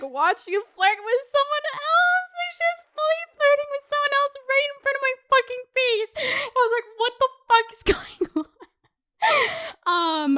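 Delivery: high-pitched, half-laughing retold outrage; incredulous outrage. Retelling date flirting in front of her, incredulous. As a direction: pitch climbing into a squeal of disbelief.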